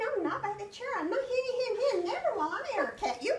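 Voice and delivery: high, squeaky pig refusal, rushed at the end; little pig squeaking back at the wolf; frightened little pig. Acted. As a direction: squeal no like a scared piglet.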